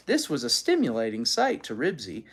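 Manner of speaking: ordinary delivery, nothing marked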